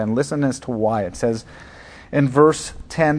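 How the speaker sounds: ordinary talk, nothing marked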